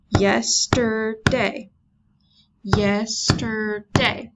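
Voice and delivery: Yesterday said very slowly, YES-TER-DAY; slow teacher demo, Yesterday beat by beat; syllables tapped out. Shown as a demonstration. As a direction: stretch each syllable of Yesterday for a clap. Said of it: "saying these and clapping on your table".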